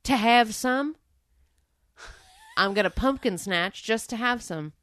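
lightly voiced country boy; imitating the Southern guy's simple reason; Southern drawl. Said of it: "He just goes".

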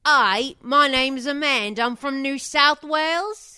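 Australian accent going British, high and loud. Shown as a demonstration. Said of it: "it gets a little Cockney".

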